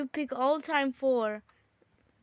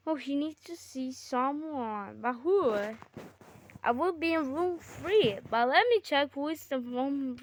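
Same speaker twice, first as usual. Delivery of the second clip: ordinary delivery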